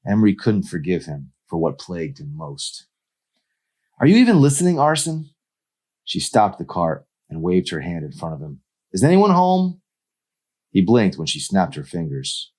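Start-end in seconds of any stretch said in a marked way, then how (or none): 4.0-5.3 put-on high, louder young woman voice; higher, irritated girl voice; Emery's voice. 8.9-9.8 put-on high, louder young woman voice; higher, irritated girl voice; Emery's voice. Emery snapping at Arson.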